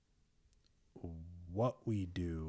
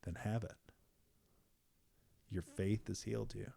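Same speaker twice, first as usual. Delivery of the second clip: soft, understated acted quote; hushed, simple pronouncement; quiet, calm reply; 7 dB quieter than usual. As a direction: say it softly, letting it land. Jesus's reply voiced in retelling.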